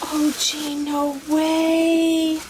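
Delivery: thrilled disbelief. Real, not acted. To the camera about the gift, thrilled disbelief. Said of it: "so, so excited".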